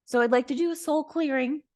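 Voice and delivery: chirpy high voice, rattled off fast; imitating the psychic. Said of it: "She's like".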